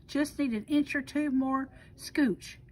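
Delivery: ordinary delivery, nothing marked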